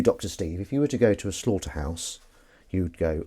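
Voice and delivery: ordinary delivery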